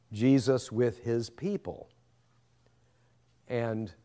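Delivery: ordinary talk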